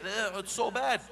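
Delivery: loud, high disgusted groan; annoyed parent. Acted. Parent fed up with child breaking things. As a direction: scold the kid, fed up. Parent scolding kid who broke something.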